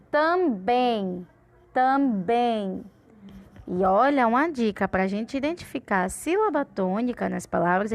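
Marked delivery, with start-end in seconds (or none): 0.1-2.8 "Também" in two slow beats, "bem" stronger; stress on bem. Shown as a demonstration. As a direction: stretch "Também" and push the last syllable "bem". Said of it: "que a gente fala mais alto".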